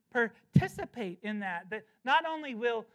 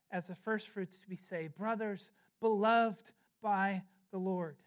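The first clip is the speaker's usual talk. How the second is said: scripture reading; 5 semitones lower than usual. Acted. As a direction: read the verse gently to the flock.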